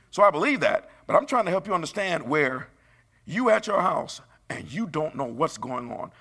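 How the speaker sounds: high, loud building preacher cry; preaching loud. Real, not acted. Preacher warning the congregation, loud and rising. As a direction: raise it to the back pew.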